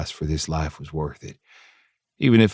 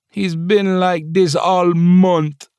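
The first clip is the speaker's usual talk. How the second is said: high, slow Jamaican grumble; grumpy Jamaican accent; 11 semitones higher, 5 dB louder, much slower than usual. Acted. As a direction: grump it out like a fed-up Jamaican chef. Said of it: "Shanna grumped".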